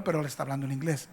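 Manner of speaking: ordinary delivery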